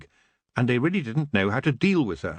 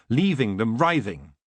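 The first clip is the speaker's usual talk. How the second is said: usual speaking voice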